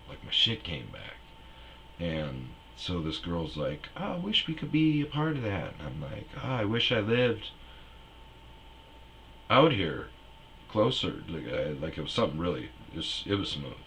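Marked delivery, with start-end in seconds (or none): 4.0-5.7 high-pitched imitation of the girl, loud; high girly voice. Comic doing the gas station girl, high and loud. 6.4-11.2 smooth confident line, a bit higher; lightly voiced smooth talker. Comic replaying his smooth line to the girl.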